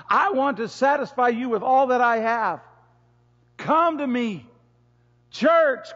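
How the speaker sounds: loud, high, impassioned preaching. Acted. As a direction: preach it out full force to the church. Preaching at full force.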